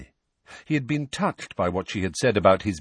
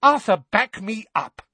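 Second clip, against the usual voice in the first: high, loud demand for backing; rounding on husband; 6 dB louder than usual. A narrator's reading. Mrs Weasley turns on her husband for support, indignant. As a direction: turn on him sharply, pitched right up. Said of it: "rounding on her husband".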